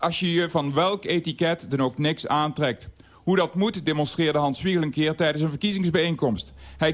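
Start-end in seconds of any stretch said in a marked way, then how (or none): none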